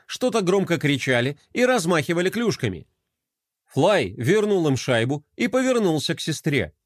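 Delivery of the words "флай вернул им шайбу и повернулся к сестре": ordinary delivery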